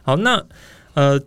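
ordinary talk

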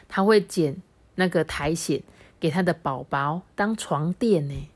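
ordinary delivery, nothing marked

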